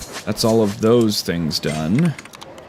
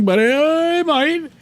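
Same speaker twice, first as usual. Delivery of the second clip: comic wail. Pretend. Player dreads a long wait, comic high wail. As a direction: stretch it out high, then come down.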